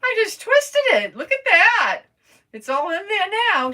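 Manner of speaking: high, loud, thrilled squeal; excited squeal. Real, not acted. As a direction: squeal it with delight. Thrilled that the piece twisted in.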